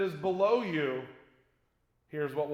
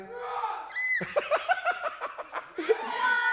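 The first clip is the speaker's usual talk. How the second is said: big laugh. Real, not acted. Crowd laughs at punchline.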